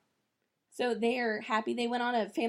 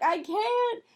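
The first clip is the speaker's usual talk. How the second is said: high, louder cracking plea; mock sob, pitched way up; retold sobbing; 6 dB louder than usual. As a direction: cry out the refusal through tears. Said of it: "And I'm sobbing".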